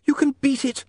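high, urgent woman's encouragement. A narrator's reading. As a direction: urge him on, pitched high. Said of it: "she said urgently".

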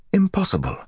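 high-pitched burst of disbelief; incredulous exclamation; shocked disbelief. A narrator's reading. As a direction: refuse to believe it, voice leaping up. Gawain reacts in shocked disbelief.

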